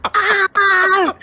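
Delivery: high imitated yelp of surprise; startled yelp. Imitating boyfriend startled by the baby peeing, high yelp. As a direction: yelp like you just got peed on. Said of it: "and he goes".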